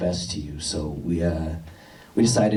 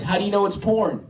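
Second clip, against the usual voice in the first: high, cheeky comeback; cheeky student; 11 semitones higher than usual. Retold or imitated. Student needling his teacher, high and cheeky. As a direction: pitch it way up like a smug kid.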